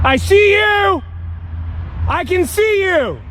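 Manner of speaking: very high, slow yell across the field; drawn-out yell. Real, not acted. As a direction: call out far to the wood line. Camper yelling at figures by treeline.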